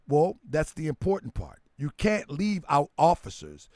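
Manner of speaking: ordinary talk, nothing marked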